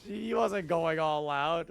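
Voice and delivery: mock protest, loud and high; upset fans. Streamer mimicking fans upset about the fight, loudly indignant. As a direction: imitate a fan insisting, drawn out at the end. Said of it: "fans were upset about that".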